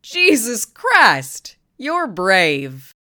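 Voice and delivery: loud, drawn-out amazed yell. Acted. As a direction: shout it out in disbelief. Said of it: "she yelled".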